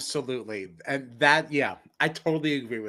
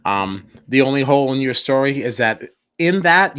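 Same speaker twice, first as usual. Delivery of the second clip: ordinary delivery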